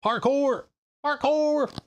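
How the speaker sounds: high-pitched gleeful shout; excited Parkour yell. Pretend. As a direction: squeal it like the meme. Shouting card name like meme.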